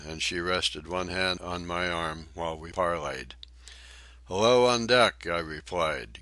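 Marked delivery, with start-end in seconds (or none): 4.2-5.3 loud, higher call up to the deck. A narrator's reading. Calling up from the hold.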